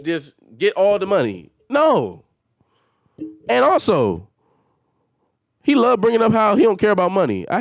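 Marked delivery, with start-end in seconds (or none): none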